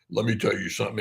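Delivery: understated imitation of a stern father; a hint of stern authority, retold; stern dad. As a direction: lightly mimic a dad laying down the law, kept soft. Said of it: "real deep stern tone of voice".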